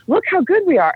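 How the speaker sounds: high-pitched mock boasting; mock self-congratulation. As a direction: imitate institutions patting themselves on the back. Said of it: "pat themselves on the back and say".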